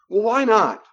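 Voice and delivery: high, loud, indignant challenge; pushy demand. Acted. Boundary buster indignantly challenging the polite no, loud. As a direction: demand an answer, offended.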